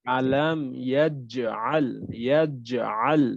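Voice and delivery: আলাম ইয়াজআল slowly, with a clear জ; drawn-out Arabic recitation. Shown as a demonstration. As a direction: stretch ইয়াজআল, saying জ as jim. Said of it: "তো এখানে হলো জিম". (ধীরে, স্পষ্ট জিম উচ্চারণে আলাম ইয়াজআল)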